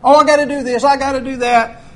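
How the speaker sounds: mock excuses. Preacher mimics a follower making excuses, loud. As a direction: act out a busy excuse-maker. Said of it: "you can say".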